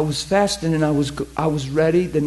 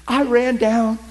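ordinary talk, nothing marked

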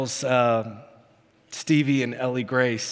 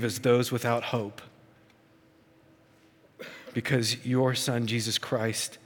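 prayer. Acted. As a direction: pray humbly over the room. Leads closing prayer.

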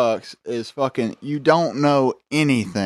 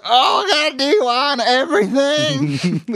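put-on drunk man shouting back. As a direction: whine it out like the drunk from the cell. Drunk man answering guard.

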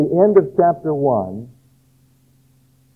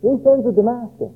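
higher, eager agreement from the listeners; approving crowd voice; 7 semitones higher than usual. Retold or imitated. Israelites in the crowd agreeing the neighbours deserve it, higher and eager. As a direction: nod along, glad someone else gets judged. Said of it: "you can almost hear the people say".

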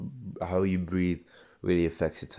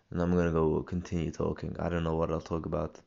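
ordinary talk

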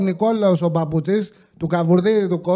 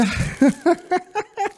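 laugh; 9 semitones higher than usual. Real, not acted. Laughs while listing pies.

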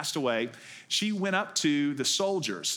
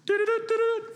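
high piping flute imitation; flute toots; 5 dB louder, faster than usual. Boy playing his flute to call the sheep, high piping toots. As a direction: toot it like a little flute. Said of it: "one of the sheep goes".

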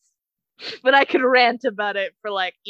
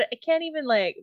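normal talking voice

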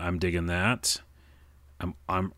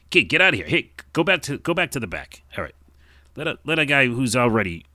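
put-on high, loud fast-talking old-time tough guy; 1920s wiseguy character; 6 semitones higher, 6 dB louder than usual. Tough guy telling the kid to scram, loud wiseguy. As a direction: shoo the kid off like a gruff 1940s mobster.

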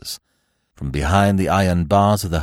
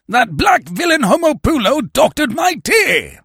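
torrent of pent-up wrath; high, raging shout easing to indignant bluster; Sir Lionel Barton; 9 semitones higher, 3 dB louder than usual. A narrator's reading. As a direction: rant furiously, starting very high and loud. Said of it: "unloosed a torrent of pent-up wrath".

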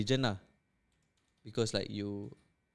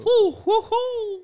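high hooting exclamation; 6 dB louder, much slower than usual. Real, not acted. Reacting to heavy topic.

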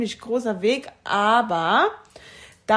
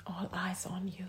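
whispering; 2 semitones lower, 13 dB quieter than usual. Real, not acted. Echoes song title softly.

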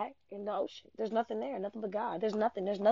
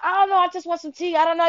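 higher, louder, petulant friend's voice; whiny friend; 8 semitones higher, 6 dB louder, faster than usual. Retold or imitated. Friend refusing coffee, high and whiny.

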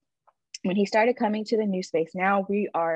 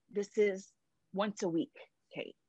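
usual speaking voice

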